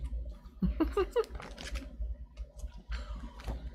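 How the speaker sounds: high, soft, airy laugh; quiet wheezy giggle. Real, not acted. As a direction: chuckle softly at your own joke. Laughs at own OG joke.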